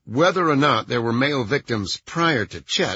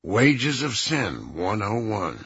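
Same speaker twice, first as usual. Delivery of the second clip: sour; 4 semitones lower, slower than usual. A narrator's reading. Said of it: "He let out a sour laugh".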